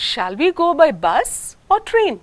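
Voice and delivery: "bus" rising, "train" pitched high; alternative question tune. Shown as a demonstration. Teacher demonstrating a choice question. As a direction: offer two choices with a rise. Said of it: "alternative questions like".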